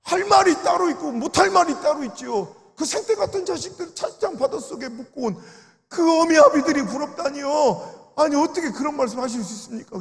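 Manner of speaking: retold outraged protest to an elder; indignant rebuke. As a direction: push back hard at the elder's words.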